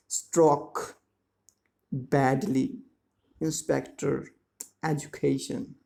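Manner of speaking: careful word-by-word model reading; slow, clear enunciation. Shown as a demonstration. Teacher models words for pupils to repeat, slowly and clearly. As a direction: pronounce each word clearly, pausing after it. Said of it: "pronounce them slowly, clearly and loudly".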